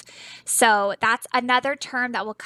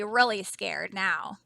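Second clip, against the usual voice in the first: ordinary delivery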